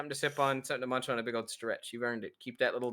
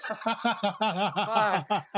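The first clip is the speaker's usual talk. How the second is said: shouted name, then laughing curse; loud exclamation; 8 semitones higher, 7 dB louder, much slower than usual. Real, not acted. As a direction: yell his name in outrage. Shouting at friend in game.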